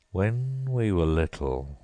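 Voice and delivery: slow, sorrowful turtle; calm, mournful. A narrator's reading. Mock Turtle recalling school days, slow and sad. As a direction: drag it out with a sob behind it. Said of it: "more calmly".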